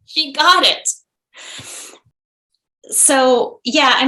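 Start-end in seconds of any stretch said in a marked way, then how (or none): 0.0-0.9 high, delighted exclamation; delighted triumph. Real, not acted. Mother celebrating her daughter's insight, delighted triumph.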